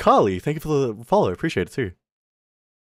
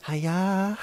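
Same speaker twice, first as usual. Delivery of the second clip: Filipino greeting; 9 semitones higher than usual. Retold or imitated. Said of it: "I just know how to be like".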